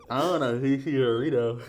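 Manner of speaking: loud, garbled through a mouthguard; wearing a mouthguard. Real, not acted. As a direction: force it out past a mouthguard. Mouthguard game reading.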